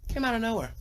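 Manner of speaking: high, falling exclamation of surprise; high-pitched repeat. Real, not acted. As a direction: squeak it out high, without pushing volume. Repeats dramatic line after digression.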